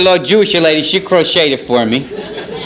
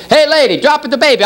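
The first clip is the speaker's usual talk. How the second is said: higher, mock call up to a window; Jerry Rice calling up to the lady, raised mock call; 11 semitones higher than usual. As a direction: shout it up three stories, hammed-up.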